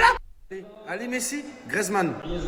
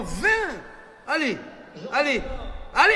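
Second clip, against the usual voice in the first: ordinary talk, nothing marked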